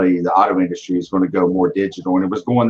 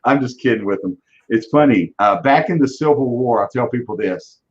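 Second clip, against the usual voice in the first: ordinary delivery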